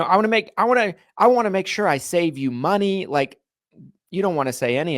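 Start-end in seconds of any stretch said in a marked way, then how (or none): 0.0-3.1 pushy, higher salesman line; sleazy pitch. Shown as a demonstration. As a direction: sell it a bit too eagerly. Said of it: "Sounds gross, sleazy".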